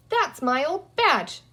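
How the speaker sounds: put-on a boy's sudden excited claim. A narrator's reading. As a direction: blurt it out as he spots his badge. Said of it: "Peter said suddenly".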